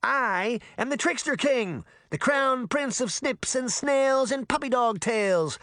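put-on high, boastful boy voice; grandiose proclamation. A narrator's reading. Trickster boy proclaims his royal titles, grandly boasting. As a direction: announce the titles high and proudly.

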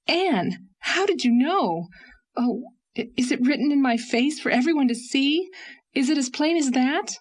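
pleading, panicked she's been seen through; distressed outcry. A narrator's reading. Leslie to Anne about her secret, a high anguished cry. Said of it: "she cried".

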